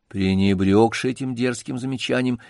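usual speaking voice

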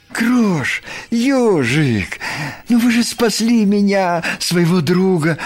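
high-pitched plaintive appeal; wounded lamb begging for sympathy; hurt pleading. A narrator's reading. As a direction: plead with your friends, high and insistent.

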